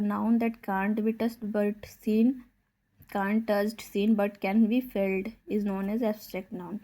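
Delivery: very slow, word by word; slow dictation. Acted. Teacher dictates a definition to the class, very slowly. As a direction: spell it out at writing speed.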